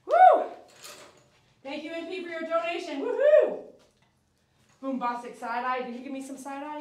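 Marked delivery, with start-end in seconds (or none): 0.1-0.4 very high, loud woo; excited whoop. Real, not acted. Streamer whooping at a viewer's donation, very high and loud. 3.0-3.6 high loud woohoo; excited whoop. Real, not acted. Streamer cheering a donation, high and loud.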